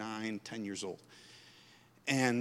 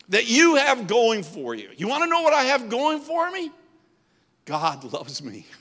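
normal talking voice

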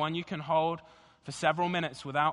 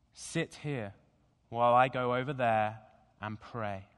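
slow, low, measured scripture reading; slow deliberate reading; 3 semitones lower than usual. Shown as a demonstration. Pastor reading Gethsemane to the congregation, slow and deliberate. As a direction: read each phrase slowly, letting it settle. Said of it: "read the passage again, again, slowly, deliberately".

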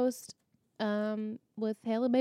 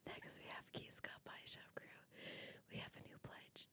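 whispered list of names; whispering; 23 dB quieter than usual. Acted. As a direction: whisper each name softly. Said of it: "whisper them all without laughing".

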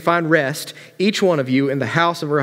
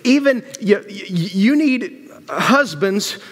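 higher-pitched, exasperated questioning; upset; 6 semitones higher, slower than usual. Acted. Naomi questioning why her daughters-in-law would follow, mildly upset. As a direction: demand an answer, mildly upset. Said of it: "Do you see how upset Naomi is".

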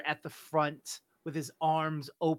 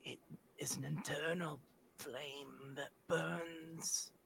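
slow, hushed ghostly whisper; drawn-out spectral whisper; whispering flame entity; 6 dB quieter, slower than usual. Acted. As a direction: whisper it slowly, like an eerie spirit in the fire. Flame spirit speaks in whisper.

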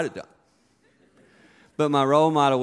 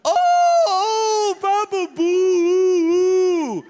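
very high, loud, whiny wail with "Boo" drawn out; a teenage son taunting his dad; hammed-up fake cry of mockery; mocking son; 6 dB louder, much slower than usual. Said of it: "He's like".

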